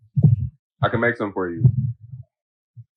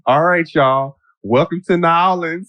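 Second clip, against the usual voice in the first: flight attendant's overdone Louisiana greeting, higher; put-on New Orleans accent; 7 semitones higher, slower than usual. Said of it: "the New Orleans accent, the, the Louisiana accent".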